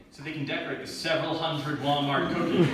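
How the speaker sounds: usual speaking voice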